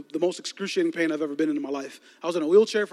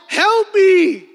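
loud, high reenacted scream; screaming for help; 12 semitones higher, 8 dB louder than usual. Crash victim calls to a passing driver, screaming. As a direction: belt out a drawn-out cry for rescue. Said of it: "So I just start screaming".

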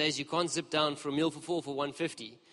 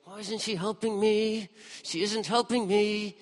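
higher, slow whining; whiny complaint; 6 semitones higher, slower than usual. Retold or imitated. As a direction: sulk it out like a put-upon sister. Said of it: "she's probably going".

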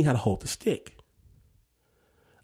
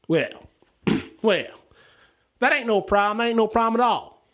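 put-on folksy old farmhand, higher-pitched; 6 semitones higher than usual. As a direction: play an older farmhand, voice lifting. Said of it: "He's an older farmhand guy".

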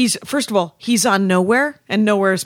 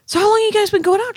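high, quick, eager gossip question; pry cheerfully; nosy chatty question; 12 semitones higher, 3 dB louder, faster than usual. Retold or imitated. Asking Sarah about her boyfriend, eager and high. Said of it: "and I say to Sarah".

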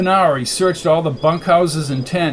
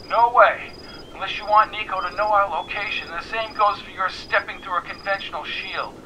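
higher, thin phone voice; voice over a device; 4 semitones higher than usual. A narrator's reading. Annie warns McKenna against the trip, thin phone voice. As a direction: object through a filtered line.

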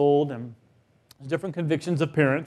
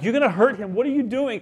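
alarmed parent; 6 semitones higher than usual. Retold or imitated.

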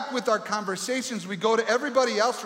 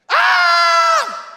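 sudden shriek; 12 dB louder than usual. Pretend. Sudden outburst in pacifier story.

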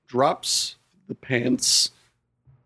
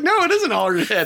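insistent, a bit higher; mock indignant retort; 5 semitones higher, faster than usual. Retelling his pushback to the buddy, indignant. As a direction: push back on the crazy claim. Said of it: "And I was like".

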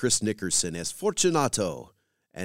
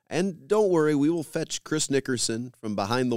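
higher, louder mock-comforting aside; playful mock reassurance, pitched up and loud; teasing reassurance; 7 semitones higher, 4 dB louder than usual. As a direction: tease the listener with a wink. Host jokes about the walled-up actor.

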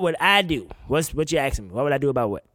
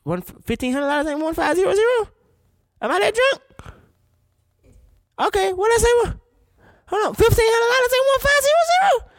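high, squeaky disbelief, voice climbing louder; can't believe the math he just did; stunned disbelief; 5 dB louder than usual. Real, not acted. Host rechecking the wedding money sum, high stunned disbelief.

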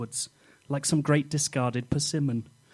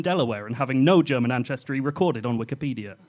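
ordinary talk, nothing marked

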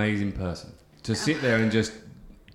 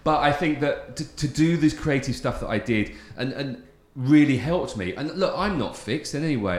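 teary voice; 5 semitones higher, 4 dB louder than usual. Real, not acted.

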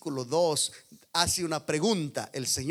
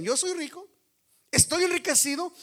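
higher, proud mock boast; boastful; 13 semitones higher, 5 dB louder than usual. Laodiceans boasting to God, mock proud voice. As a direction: puff up like a rich man. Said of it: "Ellos decían".

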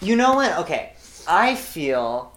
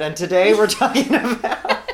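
lightly voiced schoolteacher; teacher voice; 7 semitones higher, 4 dB louder than usual. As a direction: announce the lesson like a teacher. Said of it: "A teacher said".

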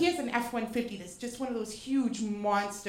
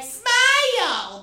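sudden loud, higher shout of the word; mock catcall; 9 semitones higher, 9 dB louder than usual. Pretending to tell [name] to smile, loud mock catcall. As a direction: bark it like a street catcaller. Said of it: "flip the script on feminism and be like".

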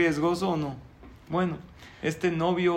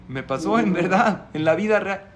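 loud, higher protest; insisting; 4 semitones higher, 9 dB louder than usual. Retold or imitated. Groom protesting to the rabbi that it was real, loud. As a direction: protest that it really happened.

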